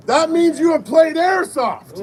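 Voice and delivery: loud, high, slow projection; calling out. Real, not acted. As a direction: punch it out slowly to the whole crowd. Calls out answer to the crowd.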